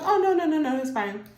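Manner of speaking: overly sweet denial; breezy brush-off. Acted. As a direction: Rashida waving off Bridget in the hallway. Rashida brushes off Bridget.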